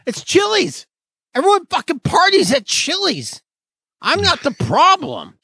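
high, squeaky defensive partier voice; mock whiny partier. Friend mocks a Chili's troublemaker, high squeaky whine.

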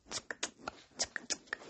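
soft clicking tsk tsk of disapproval. A narrator's reading. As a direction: click your tongue at him, softly. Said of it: "She made a tsk, tsk sound".

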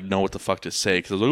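normal talking voice

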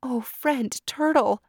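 put-on high, alarmed young deer; high anxious doe voice; Deer's voice. A narrator's reading. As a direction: cry out his name in worried surprise. Deer worried for Turtle.

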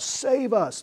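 high-pitched imitation of a cry for rescue; mock scream. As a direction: cry out urgently without full volume. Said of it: "and we scream out".

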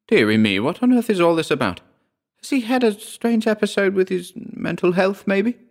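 a fretting auntie, dearie me; worried auntie. Acted.

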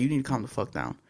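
soft, controlled reenacted warning; quietly telling someone off, without raising the voice; calm, firm retelling. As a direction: keep the volume down and stay level. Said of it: "I said it just like that".

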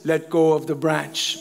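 deep, slow voice from heaven; God. Retold or imitated. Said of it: "then God says".

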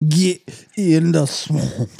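high, slow Louisiana drawl of a swamp villain; Louisiana accent. Acted. Friend jokes about the Swamp Thing villain in a Louisiana accent. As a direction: ham up a Louisiana bayou accent. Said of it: "Can you do a Louisiana accent?".

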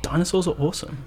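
high, fast and gleeful; gushing dinosaur fan. Real, not acted. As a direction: blurt it out like a proud fan. Enthusiastic fan declaration.

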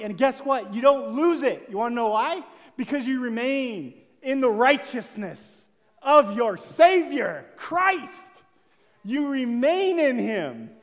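loud, rousing preaching at full force; fiery preaching. Acted. Preacher urging the church to get excited, fiery preaching. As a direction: preach it hard to lift the room.

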